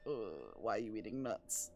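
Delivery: low, quiet grumble; muttered disgust. Acted. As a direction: complain under your breath while slinking away. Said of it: "she just kind of shuffles away like".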